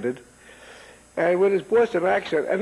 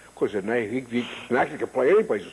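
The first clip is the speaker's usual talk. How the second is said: lightly imitated British actor, low; a hint of deep-voiced British grandeur; Cedric Hardwicke; 7 semitones lower than usual. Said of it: "beautiful, deep voice. He had a British".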